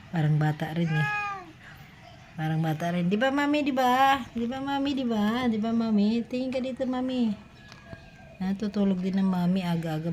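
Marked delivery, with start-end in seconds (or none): 3.1-7.3 coaxing pet talk. Real, not acted. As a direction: coo gently for agreement and attention.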